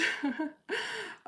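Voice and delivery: very high giggle; amused laugh. Real, not acted. Laughs after her own question.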